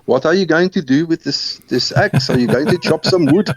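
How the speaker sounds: put-on high, childlike South African accent with a laugh; little South African accent. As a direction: ask it innocently in a young boy's South African accent. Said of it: "in my little South African accent".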